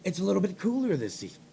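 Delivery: low, hushed easy-listening voice; soft late-night murmur; talking soft. Pretend. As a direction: drop right down, very soft and low. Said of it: "I gotta talk soft".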